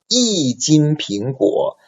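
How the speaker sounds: "一斤苹果" said slowly, 一 on a falling fourth tone; slow tone demo. Shown as a demonstration. Teacher models the example for students, slow. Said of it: "changed into fourth tone".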